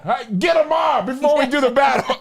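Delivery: high, shouted call to the troops; mock battle cry. Rallying pretend brothers for battle, mock battle cry. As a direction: holler it like a film warrior.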